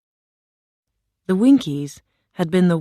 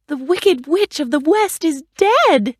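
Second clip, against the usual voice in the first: high, louder cry of relief; joyful Dorothy; 10 semitones higher, 4 dB louder than usual. A narrator's reading. Dorothy telling the lion the witch is dead, joyful. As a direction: announce the good news with joy. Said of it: "Dorothy cried".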